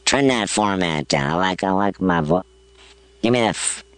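very low processed voice effect; deep voice filter. Real, not acted. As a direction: talk through a low pitch-shifter. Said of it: "Give me a little something".

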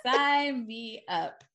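slow, savoured repeat of a joke; very slow and emphatic; drawn-out emphasis. Real, not acted. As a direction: stretch each word out, relishing it.

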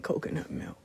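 breathy hushed coconut milk, no voice; soft whisper aside. Shown as a demonstration. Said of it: "they have the coconut milk".

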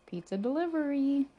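high, loud delivery call. A narrator's reading. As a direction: call it out to those inside. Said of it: "called an unfamiliar voice".

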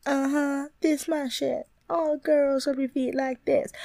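high, slow sassy voice; put-on sassy voice. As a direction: strut it out with attitude. Acting out a character.

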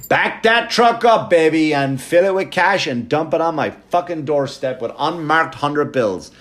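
hammed-up greedy rant; mock demand. Real, not acted. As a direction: insist on a truck of cash with swagger. Demanding pay for private gigs.

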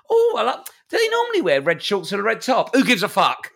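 high, airy, clueless football questions; mock a clueless fan, high and silly. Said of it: "I can't have someone go".

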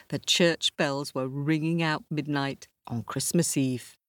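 usual speaking voice

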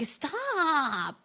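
retold drawn-out objection; drawn-out protest. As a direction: stretch the word into a mock plea. Acts out plea to pinching brother.

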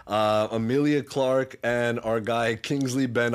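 usual speaking voice

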